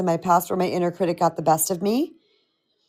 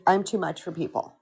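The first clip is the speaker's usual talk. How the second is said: softer, resigned self-judgment; heavy self-doubt; 2 semitones lower, 5 dB quieter than usual. Shown as a demonstration. Coach voicing the inner critic, quiet and resigned. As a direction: murmur it as a harsh inner critic. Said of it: "That's a very definitive statement".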